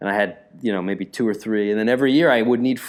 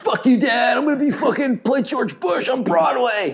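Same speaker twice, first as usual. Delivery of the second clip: very high, over-the-top yell; hammed-up rebellion against a father; mock defiant outburst; 13 semitones higher than usual. Mock outburst at dad, acted.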